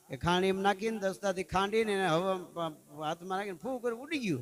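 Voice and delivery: ordinary delivery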